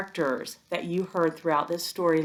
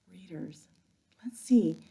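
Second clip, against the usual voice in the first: hushed whisper; whisper to readers; 4 dB quieter than usual. Real, not acted. Teacher pausing the story to whisper to readers. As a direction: lean in and whisper.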